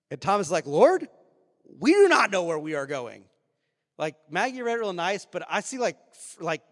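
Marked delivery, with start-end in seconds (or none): 0.7-3.3 frantic. Acted. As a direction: blurt it out in dramatized panic. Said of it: "I think Thomas is pretty frantic".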